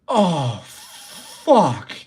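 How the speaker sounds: loud, drawn-out moan of bliss; hammed-up groan of pleasure; acted food ecstasy. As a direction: moan as if blown away by a bite. Acts out bliss at first bite.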